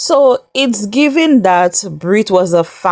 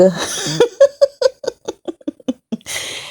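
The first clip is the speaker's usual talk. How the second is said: big laugh; 11 semitones higher, 4 dB quieter than usual. Real, not acted. Recapping a funny reality-TV moment.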